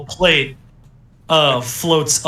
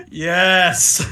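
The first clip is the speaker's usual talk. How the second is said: excited; 4 semitones higher, 4 dB louder than usual. Real, not acted. GM excited to play animal.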